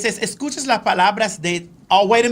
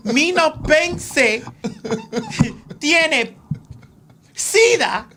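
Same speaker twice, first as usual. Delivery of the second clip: slow, laughing retelling; 5 semitones higher, much slower than usual. Real, not acted. Retelling her joke, laughing slowly.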